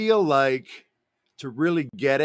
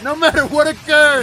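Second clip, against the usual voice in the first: dramatic high voice; 12 semitones higher, 10 dB louder than usual. Pretend. Dramatic game character voice.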